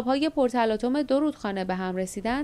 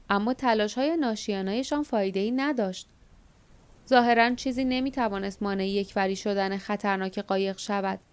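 ordinary delivery, nothing marked

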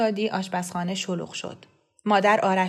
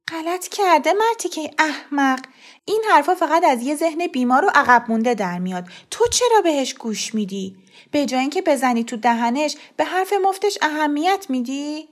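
higher, sharp indignant scolding; indignant mother; 7 semitones higher, 4 dB louder than usual. A narrator's reading. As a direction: scorn the gossip. (مادری برآشفته با صدای زیرتر)

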